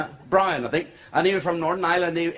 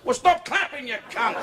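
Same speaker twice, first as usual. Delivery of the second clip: mock shout; 6 semitones higher than usual. Heckling retort to [name].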